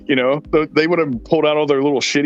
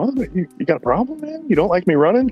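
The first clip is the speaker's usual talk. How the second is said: fast, higher challenge, squaring up; confrontational challenge; 7 semitones higher, faster than usual. Retold or imitated. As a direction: get in his face, fast and cocky.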